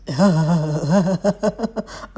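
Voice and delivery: a warm burst of laughter; real amused laugh; hearty laugh. Real, not acted. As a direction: laugh out loud at the joke. Laughing at the dragon's joke.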